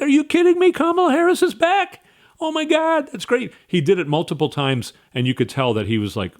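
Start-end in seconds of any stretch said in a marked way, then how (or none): 0.0-3.5 thrilled crowd. Retold or imitated. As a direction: gush like an excited fan in the crowd. Crowd cheering Harris, retold.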